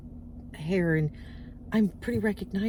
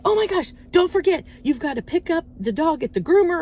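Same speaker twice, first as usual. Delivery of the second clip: higher and louder burst of remembering; sudden alarmed reminder; 7 semitones higher, 5 dB louder than usual. Retold or imitated. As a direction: blurt it as it hits you.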